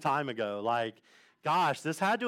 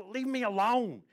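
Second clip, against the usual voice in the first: tearful rebuff; 6 semitones higher than usual. Retold or imitated. Crying friend rebuffs him, retold.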